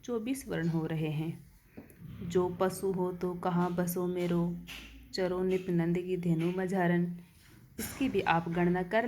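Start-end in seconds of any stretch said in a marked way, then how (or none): 2.2-7.2 poem recitation. Shown as a demonstration. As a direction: recite the Raskhan line calmly and evenly. Teacher recites next verse line.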